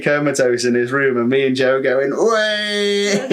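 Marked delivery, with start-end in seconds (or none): 2.1-3.2 mock cheer. Cheering while taking selfies.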